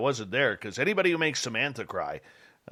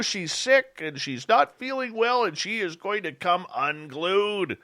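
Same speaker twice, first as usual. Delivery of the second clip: urgent warning; 7 semitones higher, slower than usual. Retold or imitated. Warning that Lorian will explode.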